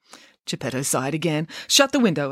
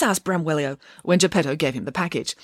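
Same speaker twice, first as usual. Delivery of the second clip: plain reading voice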